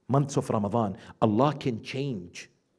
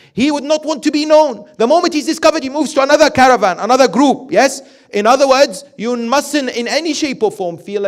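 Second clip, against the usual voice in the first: loud, higher preaching voice, driving each point home; fervent preaching; 14 semitones higher, 14 dB louder than usual. Acted. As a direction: preach it out to the congregation. Preaching at full force.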